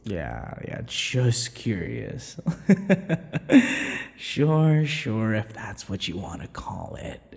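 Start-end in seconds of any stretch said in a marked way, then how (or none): 2.4-4.1 loud high teasing laugh. Acted. Vampire laughing at captive's excuse, loud teasing laugh.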